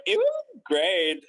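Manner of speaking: high, slow, stretched-out answer; smiling cover-up. Retold or imitated. Employee answering the boss about the interview, smiling cover-up. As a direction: smile through an awkward retold reply. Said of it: "I just smiled".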